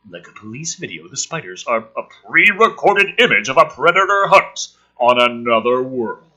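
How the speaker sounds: loud, urgent action read; thrilling storyteller read; dramatic script reading. Acted. Reading screenplay aloud dramatically.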